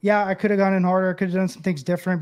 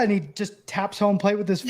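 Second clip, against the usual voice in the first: ordinary delivery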